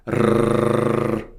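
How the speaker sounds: long trilled rrrr; rolled r. Shown as a demonstration. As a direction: roll the R out steadily. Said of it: "the trilling sound".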